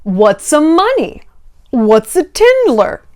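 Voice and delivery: slow, drawn-out fake ignorance; playing dumb. Guilty person denying everything when confronted, exaggeratedly clueless. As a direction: stretch each question as if the word is foreign. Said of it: "a guilty person can also be like".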